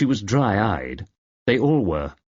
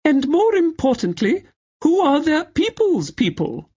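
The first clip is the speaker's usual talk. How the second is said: very high, crystal-cut upper-class accent, mocking; Letitia's crystallized accent. Cousin mocking Letitia at dinner in her upper-class accent. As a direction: lay on the aunt's clipped upper-class accent. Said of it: "in faultless imitation of Letitia's crystallized accent".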